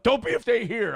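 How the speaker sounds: high-pitched imitation of an angry demand; mock insistent yell. Nick insisting his departing friend stay, mock yelling high.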